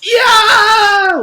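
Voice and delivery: very high, loud yelp; girl shrieking as Paul yanks her pigtail; yelp of pain. Acted. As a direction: yelp as the hair is pulled. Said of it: "Leslie yelped".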